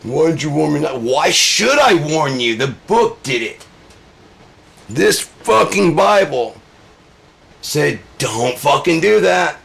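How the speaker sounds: loud, high indignant rant; acted angry outburst, pitched high; exasperated shouting. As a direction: throw the question back at them, yelling. Acted angry reply about the warning.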